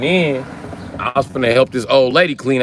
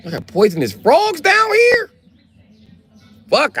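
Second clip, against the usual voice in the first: shrieked, drawn-out falsetto disbelief; shocked outburst; 4 dB louder, slower than usual. Retold or imitated. Newcomer learning of poisonous frogs, high shocked squeal. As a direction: shoot way up into an incredulous squeal.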